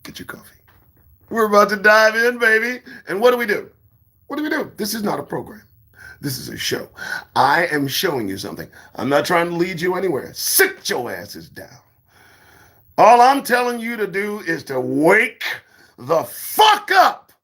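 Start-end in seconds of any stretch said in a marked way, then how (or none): 0.0-0.6 whisper. Acted. Aside to viewers. 14.8-17.3 high, slow shouted ending. Acted. Telling viewers to wake up, a high slow shout.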